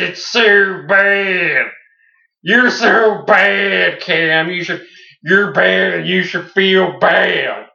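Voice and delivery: loud, slow, drawn-out mock outrage; mock rant. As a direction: ham up the trash talk, stretching every word. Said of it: "It's not what you say, [name]. It's how you say it".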